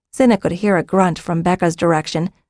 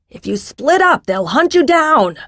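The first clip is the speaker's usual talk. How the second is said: high-pitched urgent warning; yelling; 8 semitones higher than usual. A narrator's reading. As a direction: call out a warning to the fleeing group. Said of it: "Jakaran yelled again".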